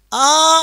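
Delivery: long-drawn demonstration "aa" on آ. Shown as a demonstration. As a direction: lift the voice and stretch آ. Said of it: "اوپر آیا".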